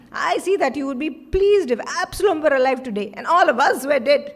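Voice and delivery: loud with a scornful laugh; mock him loudly, half laughing; bitter loud rebuke.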